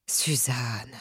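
sighed out, soft and whispery; soupir. A narrator's reading. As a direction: sigh the name wearily under the breath. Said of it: "soupira-t-il". (soupiré à voix basse, presque chuchoté)

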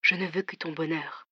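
whispered. A narrator's reading. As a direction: whisper it close to the phone. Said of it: "chuchota-t-il". (chuchoté tendrement)

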